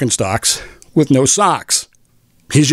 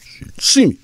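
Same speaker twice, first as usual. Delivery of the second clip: whispered aside; 8 semitones higher than usual. Real, not acted. Aside about distracted driver.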